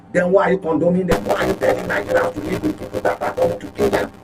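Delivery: ordinary talk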